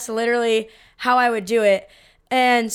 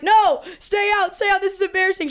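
mock panicked yell; 7 semitones higher than usual. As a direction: beg the friend to stay out, hammed up. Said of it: "I'm, like, crying".

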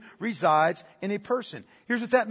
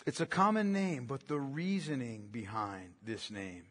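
much lower, much softer voice; hushed preaching; 8 semitones lower, 9 dB quieter than usual. Real, not acted. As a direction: drop to a low, solemn hush. Slows down to stress the name's meaning.

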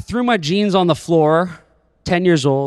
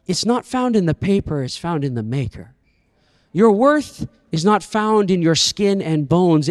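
normal talking voice

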